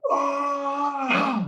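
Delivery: high straining groan as he lifts; strained cry. Retold or imitated. Sophomore strains through the last rep, crying out. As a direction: push out a yelling grunt under the bar.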